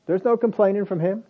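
usual speaking voice

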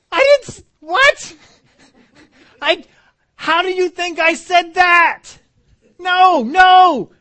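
high, loud, whiny protest with a cry; indignant kid. Retold or imitated. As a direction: whine like a kid wrongly accused.

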